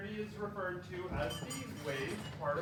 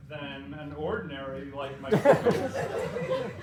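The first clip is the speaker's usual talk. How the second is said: laughing through words; 5 semitones lower, 8 dB louder, slower than usual. Real, not acted.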